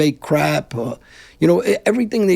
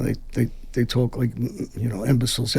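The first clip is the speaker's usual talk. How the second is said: ordinary delivery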